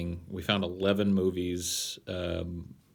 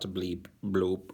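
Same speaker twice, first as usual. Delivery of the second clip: robot voice. Pretend. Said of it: "I am a robot".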